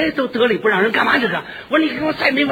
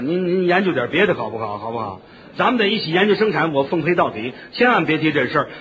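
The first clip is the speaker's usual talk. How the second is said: stubborn refusal; 8 semitones lower than usual. Retold or imitated. Husband to union chairman, lower, stubborn stammering. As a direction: dig in, stammer, then threaten the river. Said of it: "我说".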